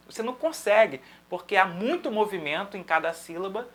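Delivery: ordinary talk, nothing marked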